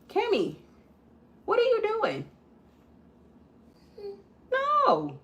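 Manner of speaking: high-pitched call across the room. Real, not acted. Mother calls out to Cammy across the room, high.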